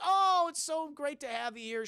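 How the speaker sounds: high-pitched doting mother voice; imitated motherly welcome; gushing mom. Mom greets son, acted.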